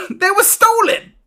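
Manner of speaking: high-pitched, plaintive protest; indignant captive. Acted. Merchant defending himself over lost books, high indignant whine.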